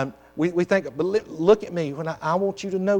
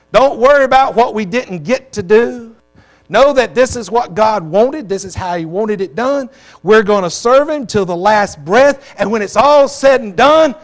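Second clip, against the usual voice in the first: loud, rising preacher's cry with higher pitch; preaching at full force; 4 semitones higher, 10 dB louder than usual. Acted. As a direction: lift the congregation, preach it out loud and slow. Preacher's funeral-song climax.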